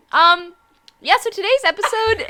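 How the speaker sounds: laughing. Real, not acted. Still laughing starting intro.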